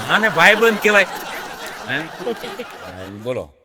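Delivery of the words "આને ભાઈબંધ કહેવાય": ordinary talk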